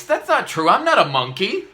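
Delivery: squeaked very high and loud, "not" stressed; shrieking mock outrage at the book; high indignant denial; indignant protest.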